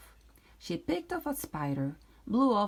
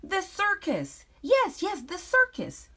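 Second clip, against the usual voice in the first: high, loud thrilled witch; excited witch; 13 semitones higher, 7 dB louder than usual. Acted. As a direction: squeal it with glee.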